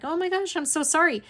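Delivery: high-pitched retold apology; apologetic. Retells apologizing to aunt.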